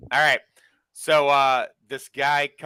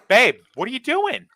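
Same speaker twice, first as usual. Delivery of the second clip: high, louder mock disbelief; shocked boyfriend; 11 semitones higher, 5 dB louder than usual. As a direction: catch her in the act, stunned. Boyfriend catching girlfriend leaving.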